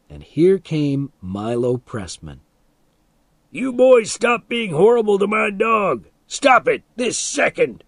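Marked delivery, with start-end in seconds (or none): 3.5-7.8 high, loud angry rebuke; scolding yell. A narrator's reading. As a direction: tell the boys off furiously. Milo scolding the boys.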